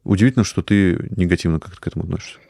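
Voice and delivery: ordinary talk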